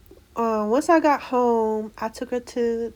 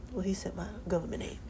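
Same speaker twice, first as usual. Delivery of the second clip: whispered aside, much softer; 2 semitones lower, 10 dB quieter, faster than usual. Real, not acted.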